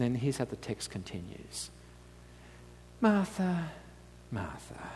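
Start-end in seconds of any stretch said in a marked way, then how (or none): 3.0-4.9 gentle rebuke. Acted. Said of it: "the Lord answered".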